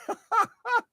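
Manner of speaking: high-pitched laugh. Real, not acted. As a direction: laugh at your own silly act. Laughs at own act.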